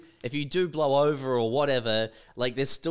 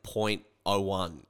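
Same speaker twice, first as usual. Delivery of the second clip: slow mock readout; 6 semitones lower, 3 dB quieter, much slower than usual. Friend imagining the reading coming back tiny. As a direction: point oh one said slowly and low. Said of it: "it's like".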